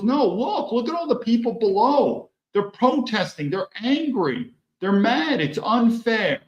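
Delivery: high, pleading voice; urgent girlfriend. Retold or imitated. Girlfriend urges him to see protests.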